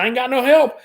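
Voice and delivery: high, indignant retelling; the new cook defends herself, high and indignant; defensive self. Retold or imitated. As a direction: push back, put upon. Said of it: "I said".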